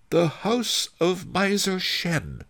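high, slow announcing voice; Fat Fu pointing things out. A narrator's reading. Fat Fu points ahead to the house, high announcing voice. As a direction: draw it out high, like a guide.

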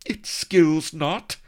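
loud, high, slow cry; loud high slow lament; cried. A narrator's reading. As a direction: cry it out urgently and slowly. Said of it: "cried Vanity".

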